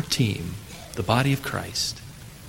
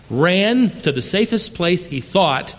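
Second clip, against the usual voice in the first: ordinary talk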